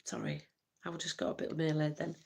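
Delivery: hushed whispered apology. Real, not acted. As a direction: whisper it off-mic. Aside while flipping camera.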